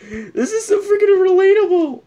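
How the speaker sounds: very high squeal, near crying. Real, not acted. Reacting to a relatable post, high squeal. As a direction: squeal it out, overwhelmed.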